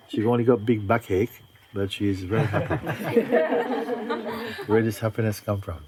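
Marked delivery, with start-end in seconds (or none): 3.3-4.6 a burst of high laughter. Real, not acted. Teacher laughing at the happy student, high laughter. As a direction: let the laugh break out high and free.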